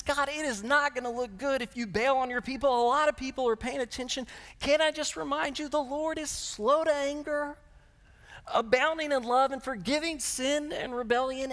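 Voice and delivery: pleading Moses. Acted. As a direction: talk God down gently as Moses. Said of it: "And Moses says".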